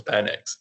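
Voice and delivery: Penix said as PEN-iks; short-e pronunciation. Shown as a demonstration. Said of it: "the pronunciation of that".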